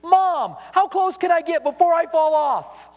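put-on high-pitched kid calling out to mom; acted child's holler, high and drawn out; child yelling back. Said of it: "he yelled back at you".

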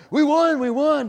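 jubilant shout. Retold or imitated. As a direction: yell it like fans after the title. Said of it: "they'd say".

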